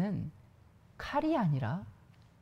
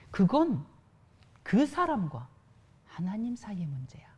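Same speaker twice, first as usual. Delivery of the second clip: whisper. Real, not acted. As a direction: confide it in a whisper. Preacher whispers.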